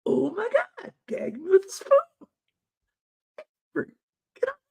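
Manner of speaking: very high mock valley girl whine; high-pitched hammed-up valley girl. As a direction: play the over-the-top teen girl. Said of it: "To be all like".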